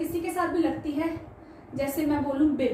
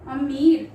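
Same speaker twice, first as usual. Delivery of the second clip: अमीर louder with held म and ई; long ee stressed; 7 dB louder, much slower than usual. Shown as a demonstration. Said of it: "किसके साथ sound आ रही है ई की मात्रा की". (अमीर को ज़ोर से, लंबी ई)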